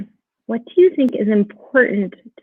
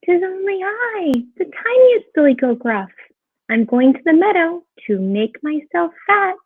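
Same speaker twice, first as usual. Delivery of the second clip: high little billy goat voice; very small voice; 7 semitones higher than usual. A narrator's reading. Littlest goat answering the troll, in a very small voice. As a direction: pipe up as the tiniest goat. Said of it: "said in a very small voice".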